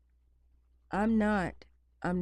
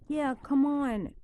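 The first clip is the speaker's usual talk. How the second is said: coaxing dog; 8 semitones higher, 5 dB louder than usual. Real, not acted.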